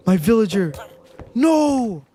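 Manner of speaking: anguished shouts. Real, not acted. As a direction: cry out in real dismay, climbing up. Villager just killed in game.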